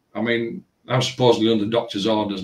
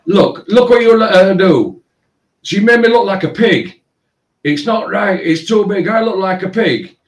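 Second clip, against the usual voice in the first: high, loud whiny mimic of an upset customer; shrill-pitched mock complaint, much higher and louder; mocking the girl; 9 semitones higher, 6 dB louder than usual. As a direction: squeal it out like a wailing teen. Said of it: "proceeded to go".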